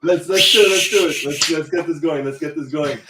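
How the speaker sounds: high loud, easing down; excited kickoff, settling; pumped up. Real, not acted. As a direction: rev the show up. Pumped kicking off show.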